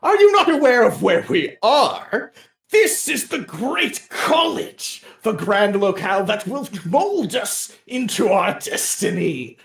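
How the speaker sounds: high, slow lofty proclamation; grand knight. Acted. Quixote gesturing at the college, lofty proclamation. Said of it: "and goes".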